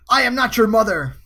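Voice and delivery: loud, high ride-character impersonation; attempted character voice. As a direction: belt the line out high, in character. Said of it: "I'll try to do the voice".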